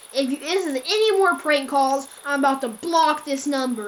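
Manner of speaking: retold annoyed warning; firm warning. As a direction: snap it at the caller. Warning a prank caller.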